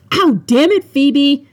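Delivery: loud, high yelp of annoyance; pained, exasperated shout. A narrator's reading. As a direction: yell at the friend who hurt you. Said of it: "Caleb shouted".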